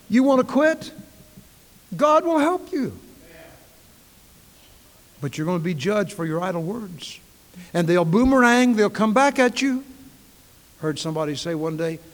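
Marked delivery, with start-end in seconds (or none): 0.1-9.8 much louder and higher, then a plain warning before the boomerang line; full-force preaching. Acted. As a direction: call out, then drive it home.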